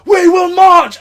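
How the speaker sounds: high, loud, slow epic war-leader shout; massive speech. Retold or imitated. Leading festival crowd to the VIP area, epic shout. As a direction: deliver it like a battle speech. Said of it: "And I'm like".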